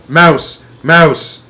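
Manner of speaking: loud alarmed cry; shouts of alarm. A narrator's reading. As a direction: cry out that a mouse is loose.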